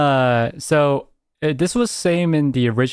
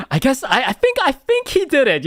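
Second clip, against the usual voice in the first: high mock voice; 8 semitones higher than usual. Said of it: "it's like".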